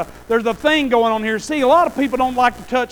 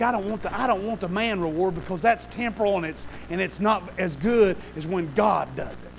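normal talking voice

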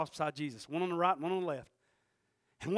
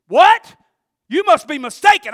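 high, loud what; stunned disbelief; 9 semitones higher, 19 dB louder than usual. Retold or imitated. The grieving son disbelieving the preacher, high and loud. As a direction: blurt it out, thrown off.

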